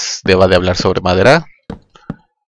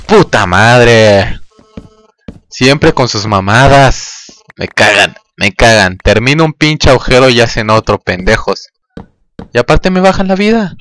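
loud angry shouting, often higher; furious ranting; 4 semitones higher, 5 dB louder than usual. Real, not acted. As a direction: yell curses at the mobs. Furioso porque destruyen su casa. (gritos de rabia contra los monstruos)

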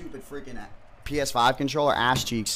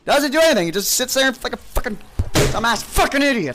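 higher and louder, spitting out the insults; furious rant; 9 semitones higher, 9 dB louder than usual. Real, not acted. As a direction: keep yelling at the useless thing. Continues raging at cup.

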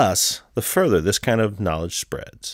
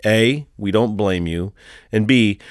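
normal talking voice

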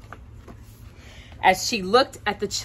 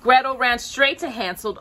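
plain reading voice

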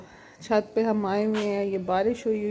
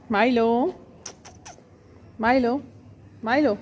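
louder, higher repeated call; calling the cat; 4 semitones higher, 4 dB louder than usual. Real, not acted. Owner calling Milo again, louder and higher. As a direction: call her name across the roof.

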